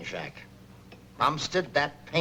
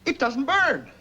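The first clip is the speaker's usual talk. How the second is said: amazed; 9 semitones higher, slower than usual. Acted. Amazed, paint won't burn.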